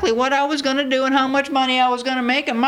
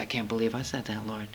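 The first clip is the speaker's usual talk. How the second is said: hushed shock; 12 semitones lower, 13 dB quieter than usual. Retold or imitated. Shocked at own words.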